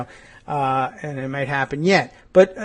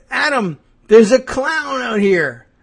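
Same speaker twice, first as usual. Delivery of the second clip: high, loud, drawn-out mock alarm; alarmed wife; 8 semitones higher, 5 dB louder, slower than usual. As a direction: call your husband in hammed-up panic. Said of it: "So she's outside, and then she's like".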